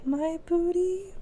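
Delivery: high, soft, slow put-on question; mock coy. Co-host reacting to the compliment, mock coy high voice.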